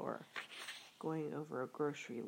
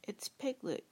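higher, eager exclamation; eager cry; 7 semitones higher, 4 dB louder than usual. A narrator's reading. Pooh recognizing his friend Piglet, eager cry. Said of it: "cried Pooh eagerly".

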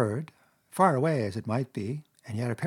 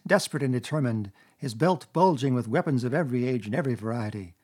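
usual speaking voice

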